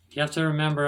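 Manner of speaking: ordinary talk, nothing marked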